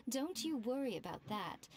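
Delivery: usual speaking voice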